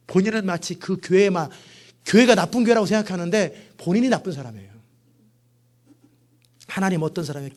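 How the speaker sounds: ordinary talk, nothing marked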